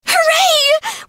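high-pitched joyful hooray; delighted cheer. Acted. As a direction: burst out with delight. Friend agrees to help, she cheers.

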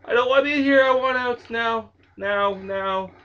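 loud pleading panic; frightened pleading. Real, not acted. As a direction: beg to get out now. Begging to leave the game.

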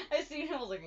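normal talking voice

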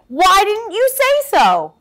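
high loud cheerful outburst; delighted. Acted. Sheep to Sheepdog, loud happy relief. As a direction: burst out in relief.

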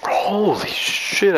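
slow, high drawn-out amazement; awestruck. Real, not acted. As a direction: stretch it out in awe. Awed at game.

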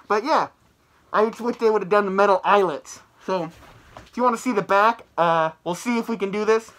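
muffled behind full head mask; through a latex mask. Real, not acted. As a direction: voice dampened by rubber mask. Seen 2: Full latex head mask covers mouth throughout the labelled words.

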